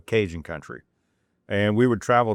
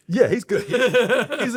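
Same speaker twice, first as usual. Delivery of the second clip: high, louder laughing reply; 5 dB louder than usual. Real, not acted. Answering whether the cousin is okay, laughing loudly. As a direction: laugh through it, amused.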